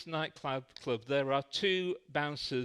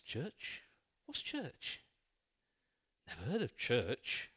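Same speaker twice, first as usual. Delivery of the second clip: baffled; 2 semitones lower, 6 dB quieter, slower than usual. Acted. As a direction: sound utterly baffled by the word. Clueless man baffled by question.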